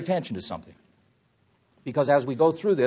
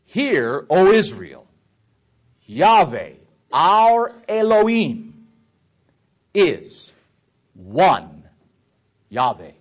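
loud, declaimed word by word with pauses; scripture reading; 9 dB louder, much slower than usual. Shown as a demonstration. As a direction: proclaim each word of the verse weightily. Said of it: "So let me read this".

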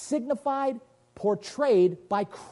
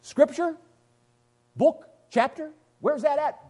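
challenging demand; 10 semitones higher, 3 dB louder than usual. Real, not acted. Challenging Billy Graham's claim.

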